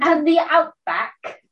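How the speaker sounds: hammy Australian "outback"; Australian accent. Retold or imitated. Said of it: "my Australian accent blows".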